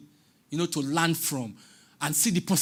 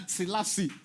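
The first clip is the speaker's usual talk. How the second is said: higher-pitched laughter around the name; real laughing; 4 semitones higher than usual. Real, not acted. Speaker amused by talk of Ethiopia and Eritrea, laughing. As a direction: break into laughter while saying the name. Amused while naming Selassie's origins.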